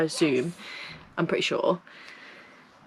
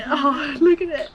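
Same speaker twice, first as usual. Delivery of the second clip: high, louder oh; retold dismay; 8 semitones higher, 4 dB louder than usual. Owner replays dismay at the loose lead, high. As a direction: replay the moment of shock. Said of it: "it was like".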